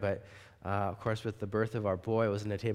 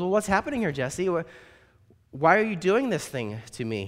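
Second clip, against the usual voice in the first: put-on high, indignant old lady; higher, louder old lady demanding answers; elderly woman scolding; 6 semitones higher, 5 dB louder than usual. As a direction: scold him like a stern grandmother. Doris questions her abductor.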